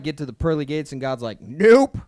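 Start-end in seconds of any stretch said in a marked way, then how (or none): 1.4-2.0 loud, high, rising one-word rejection; emphatic refusal. Acted. God rejecting him at the pearly gates, emphatic refusal. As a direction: turn them away with a comic blast.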